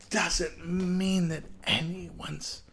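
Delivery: ordinary talk, nothing marked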